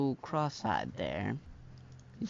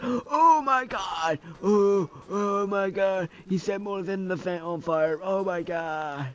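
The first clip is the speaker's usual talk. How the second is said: mock screaming panic, much louder and higher, slowed out; hammed-up panic; 8 semitones higher, 8 dB louder, slower than usual. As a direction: shriek as the robot catches fire. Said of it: "He's like".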